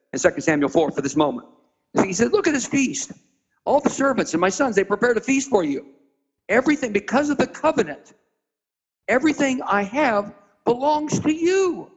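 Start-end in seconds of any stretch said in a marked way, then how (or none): 2.3-8.1 excited offer. Retold or imitated. David proudly shows the feast. 9.1-11.9 tender promise. Retold or imitated.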